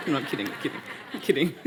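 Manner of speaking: laughing through the words; giggling, half-joking protest. Real, not acted. Author laughs at her own bowing joke, laughing through it.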